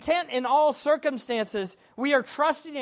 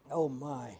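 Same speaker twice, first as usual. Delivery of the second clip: ordinary talk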